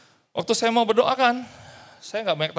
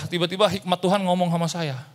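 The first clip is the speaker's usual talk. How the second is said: ordinary talk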